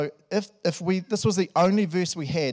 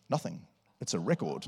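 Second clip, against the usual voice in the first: low, quieter deadpan reply; flatly lower, as if it's obvious; matter-of-fact elder; 7 semitones lower, 5 dB quieter than usual. Retold or imitated. The elder answers the pastor, low and deadpan. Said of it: "he was like".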